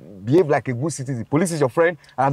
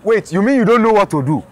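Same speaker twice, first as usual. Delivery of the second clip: higher, faster mocking retort; scoffing disbelief; 5 semitones higher, 5 dB louder than usual. Officer scoffs at the driver's question, high and fast. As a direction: wave off his question with scorn.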